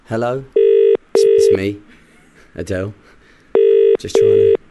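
singing [name]'s [name] line; [name] impression. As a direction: croon it like the ballad. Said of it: "This is so sad".

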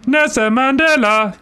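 a hint of Mandela, high and drawn out; hammed-up impression with a high lead-in laugh; lightly voiced comic impression; Nelson Mandela impression. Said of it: "my Nelson Mandela one is".

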